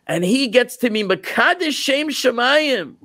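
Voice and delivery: weeping cow protesting to the prophet, high and hurt; pleading cow. Acted. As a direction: plead the injustice like a wronged child. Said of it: "He says".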